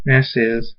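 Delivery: plain reading voice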